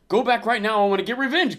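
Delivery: loud, high furious order; angry demand. Retold or imitated. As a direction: bark it out for revenge. Jaime voiced demanding revenge.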